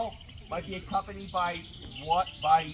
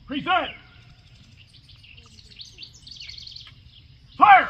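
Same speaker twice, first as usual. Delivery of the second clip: shouted command; 5 semitones higher, 10 dB louder, slower than usual. Acted. Drill commands to firing line.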